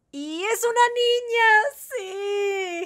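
high, loud, drawn-out cheer; thrilled high-pitched announcement; overjoyed squeal. Real, not acted. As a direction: squeal the happy news, stretching it out. Learns her baby is a girl.

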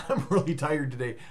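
ordinary talk, nothing marked

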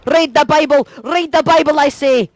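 high, commanding Irish battle cry; excited stage-Irish exclamation; mock Irish. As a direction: order them in a hammed-up Irish brogue. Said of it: "speaking Irish".

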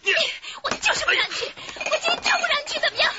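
high, loud screaming challenge; defiant shout. Acted. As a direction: dare him to go. Wife blocks husband from leaving.

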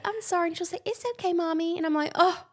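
put-on lightly high, sweet little-girl voice for the daughter; voicing her toddler. Mom voicing toddler's apology exchange.

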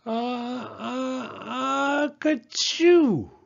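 a huge sneeze building high and bursting out loud; enormous sneeze. Acted. The bear, tickled by the mouse, lets out an enormous sneeze. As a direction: wind up the sneeze in rising gasps, then explode. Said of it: "gave an enormous sneeze".

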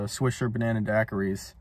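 ordinary delivery

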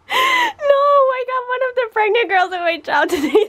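high, laughing, near-shouting; can't stop cracking up while explaining; laughing hard. Real, not acted.

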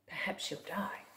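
hushed spooky whisper. Acted. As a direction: whisper the line to the child. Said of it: "whispered refrain".

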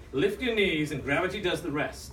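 ordinary talk

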